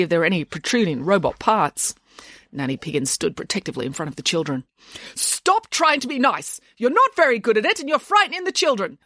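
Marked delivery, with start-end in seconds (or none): 5.2-9.0 high, forceful telling-off; accusing. A narrator's reading. Nanny protecting the children from rival, accusing. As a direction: confront her, pitched high. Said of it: "accused Nanny Piggins".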